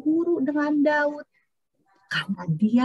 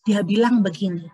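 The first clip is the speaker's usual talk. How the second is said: usual speaking voice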